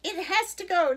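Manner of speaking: loud, high, bossy declaration; indignant storybook pronouncement; declaring. A narrator's reading. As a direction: lay down the law loudly. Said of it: "declared Missus McSnoot".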